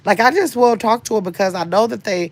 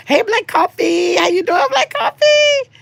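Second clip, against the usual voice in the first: much higher, louder, drawn-out hello; excited greeting; 4 dB louder than usual. Real, not acted. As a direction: call out to the newcomer with delight. Greeting a viewer joining.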